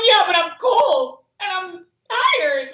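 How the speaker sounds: high, drawn-out mock whine; whiny protest. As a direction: whine back like you're feeling sorry for yourself. Whining back in retold inner argument.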